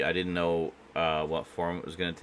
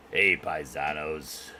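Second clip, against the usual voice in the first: mock Italian accent; 2 semitones lower, much slower than usual. Italian Mario greeting.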